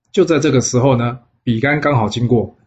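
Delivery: ordinary delivery, nothing marked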